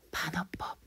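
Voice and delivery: whispered answer; soft whispered reveal. Real, not acted. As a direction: breathe the answer out. Teacher answers own quiz quietly.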